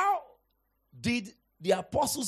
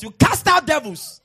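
forceful shout; 7 semitones higher, 13 dB louder than usual. Real, not acted. Preacher commanding the church, a loud shout. Preacher declaring against demons.

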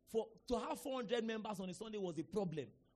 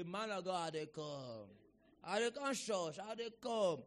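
lightly voiced drinker making excuses; mock promise from a reluctant churchgoer; imitated stalling convert; slower than usual. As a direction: put on a drawn-out Pidgin excuse.

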